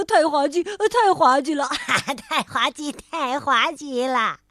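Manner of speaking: words broken up by acted laughter; helpless, hammed-up laughing fit; laughing hard. A narrator's reading. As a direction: crack up while saying it. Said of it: "大家笑得呀，眼泪都流出来了".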